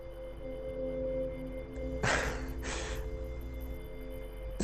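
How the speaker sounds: helpless breathy laughter; long airy laugh. Real, not acted. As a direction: laugh silently until out of air.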